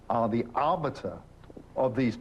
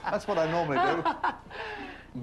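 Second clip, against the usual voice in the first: laughing; 14 semitones higher, slower than usual. Real, not acted. Jokes about who leads.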